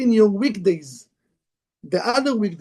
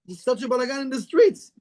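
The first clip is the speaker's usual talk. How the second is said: ordinary delivery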